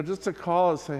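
ordinary talk

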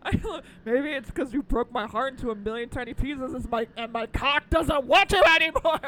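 hammed-up line reading; mock dramatic quote. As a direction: declaim it like a wounded lover. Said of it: "a line that's really stuck with me".

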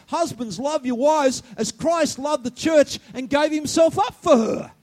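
loud, rising quote; shouting the verse at husbands; preaching shout. Real, not acted. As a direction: proclaim it at full voice. Preaching quote at full force.

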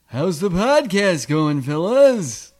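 slow, stretched character greeting; comic character voice. Acted. Host trying another variation of his character on friends, drawn-out comic voice. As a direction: draw it out in a put-on comic voice. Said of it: "that's my fourth variation".

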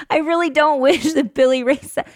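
normal talking voice

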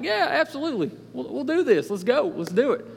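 eager, bright yes to the offer, rising high; excited yes. Retold or imitated. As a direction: jump at the chance to speak. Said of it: "I'm all excited".

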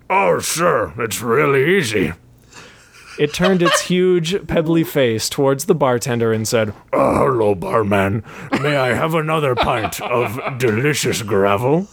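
gritty gravel-man voice; lumbering rock creature, friendly; thick crunchy voice. A narrator's reading. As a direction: crunch each word like stones. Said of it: "In a thick, crunchy voice".

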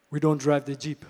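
loud, put-on African accent. Chief repeating his refusal to the pastor, African accent. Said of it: "I'm not good with accents".

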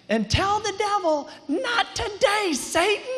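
high, slow, dramatic mock worship leader voice; imitating Morgan. Pastor mimics his worship leader taunting the devil, high and slow. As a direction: drag out "Not today" up high, playing the worship leader. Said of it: "the way she said it was so".

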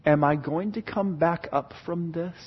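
low, soft, uncertain question; hushed inner doubt; wondering. Acted. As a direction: wonder it quietly to yourself. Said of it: "where you're just wondering".

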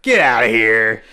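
loud, slow get-out; dismissive shout. Acted. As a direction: throw him out, slow and loud. Larry dismisses Richard in reenactment.

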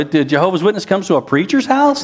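ordinary talk

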